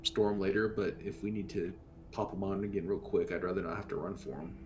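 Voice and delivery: ordinary talk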